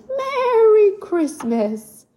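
high, slow horse-like whinny. Acted. Little colt whinnying greetings to the bear. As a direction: stretch it out like a neighing pony. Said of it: "little Star whinnies".

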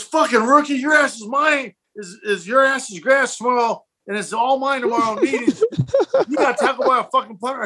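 hammed-up teammate chewing out a rookie; mock angry yell. Dion yelling at the tackled rookie, mock furious.

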